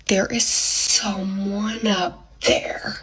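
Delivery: very slow, hushed whisper; ominous reveal. Retold or imitated. Storyteller reveals who's in the bathtub, hushed dread. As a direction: stretch each word out in a breathy whisper.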